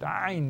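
Ain pressed out from a tight throat; Arabic letter sound demo; throat-squeezed ain. Shown as a demonstration. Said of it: "Contraction of your throat".

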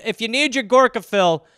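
normal talking voice

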